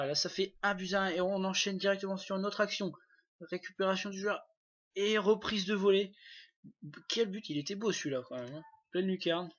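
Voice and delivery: lively play-by-play of the volley; excited sports commentary. Acted. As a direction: call the goal like a commentator. Calls volley goal. (commentaire sportif enthousiaste)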